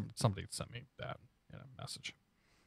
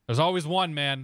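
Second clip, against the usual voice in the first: louder, amused exclamation; emphatic; 3 semitones higher, 7 dB louder than usual. Real, not acted. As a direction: exclaim it with a laugh in it. Joking about a strobe-light pilot.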